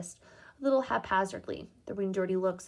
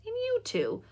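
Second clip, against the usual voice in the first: much higher stern "and you two"; single them out sharply; sharp rebuke. Acted. Mother glaring at sons.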